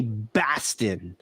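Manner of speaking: slow Boston drawl of Baston; Boston accent. Shown as a demonstration. Host demoing the Boston accent on Baston. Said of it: "they say Baston".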